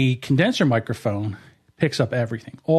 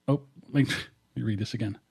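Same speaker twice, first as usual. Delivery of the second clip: normal talking voice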